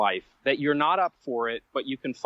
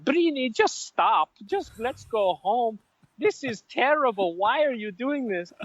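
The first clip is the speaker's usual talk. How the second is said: put-on much higher, thick Count Dracula accent; 9 semitones higher than usual. Mother begging her son to quit the marathon, high thick Dracula accent. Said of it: "very thick Count Dracula accent".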